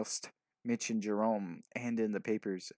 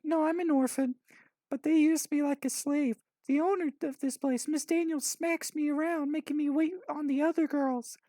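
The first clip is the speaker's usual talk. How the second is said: put-on high-pitched girl, louder; 4 dB louder than usual. A narrator's reading. Orphan girl tells the boys about her owner, high voice.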